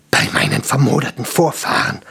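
rough, voiceless dwarf oath; hoarse, breathed exclamation; breathed in awe. A narrator's reading. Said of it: "hauchte Stramm und starrte gen Himmel".

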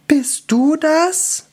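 high, slow, amazed question; gaping elf, very high voice; stunned elf. Acted. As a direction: ask it in high, drawn-out disbelief. Gaping elf asks the wizard.

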